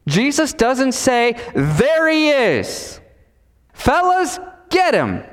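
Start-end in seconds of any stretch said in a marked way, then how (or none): 1.5-5.2 high, drawn-out call to the gang; mock accusing call. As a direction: sound the alarm and sic them on him. Mock accusation Jesus does not make.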